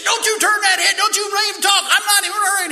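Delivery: high, loud barked orders; drill instructor yelling. Retold or imitated. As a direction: bellow don't move at him. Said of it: "He yelled at me".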